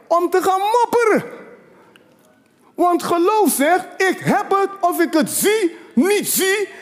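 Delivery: full-force preaching. Acted. Preacher urging the congregation to claim healing, full-force shout. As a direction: preach it hard, build to the shout.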